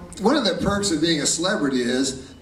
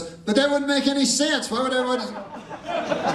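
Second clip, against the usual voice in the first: much higher, baffled dog voice; indignant dog; 8 semitones higher than usual. Retold or imitated. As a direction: play the dog, high and put out. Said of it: "And then the dog goes".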